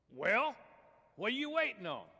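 high, loud squeaky frog; pushy cartoon frog voice; impatient frog. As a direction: demand it as a tiny impatient frog.

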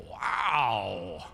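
long, drawn-out wow in mild amazement; slow, falling wow of wonder; awed wow. Acted. Visitors marvel at new building.

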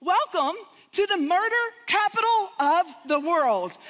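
a mock tour-guide welcome to a grim city. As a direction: announce it like a chirpy guide. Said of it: "the tour went something like this".

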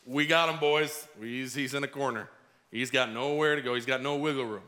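low, smug gloat to his buddies; gloating plotters. Retold or imitated. Leaders whispering among themselves about trapping Jesus, smug gloat. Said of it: "these guys are thinking to themselves".

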